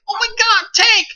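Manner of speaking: high, yelled panic over the radio; mock panic yell of tank warning; panicked shout. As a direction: shriek it like a spooked player. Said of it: "some guys are like".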